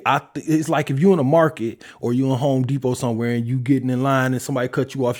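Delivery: ordinary delivery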